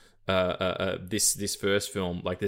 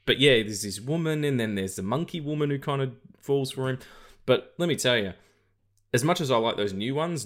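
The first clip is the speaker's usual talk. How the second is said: normal talking voice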